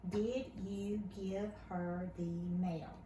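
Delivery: put-on slow, careful reading of a question for a child; slow read-aloud question. Shown as a demonstration. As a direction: read it slowly word by word, asking. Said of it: "one that ends in a question mark".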